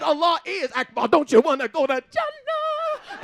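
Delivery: loud, rousing church cry; preacher shout. Retold or imitated. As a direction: preach it to the back pews. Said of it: "I said".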